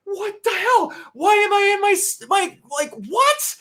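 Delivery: high, shouted bewilderment climbing to a squeaky what; confused outburst. Retold or imitated. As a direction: yell it, baffled and indignant. Retelling confusion at the chapter start.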